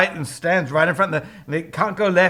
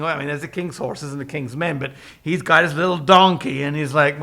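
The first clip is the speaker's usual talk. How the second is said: ordinary delivery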